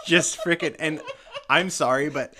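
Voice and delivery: high, loud words through laughter; laughing. Real, not acted. Host laughing while retelling the goat scene.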